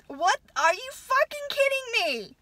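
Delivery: high, loud disbelief; outraged outburst. Real, not acted. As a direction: shout it in disbelief. Outraged at principal's reply.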